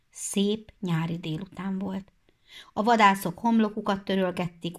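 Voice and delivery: ordinary delivery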